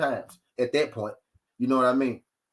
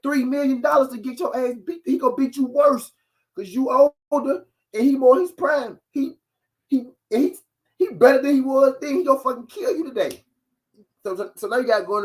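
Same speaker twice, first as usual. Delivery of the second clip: heated rant; 9 semitones higher than usual. Real, not acted.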